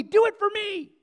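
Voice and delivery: high, loud cry for loyalty; desperate shouted plea. Pretend. Captain begging soldiers, shouted high and loud.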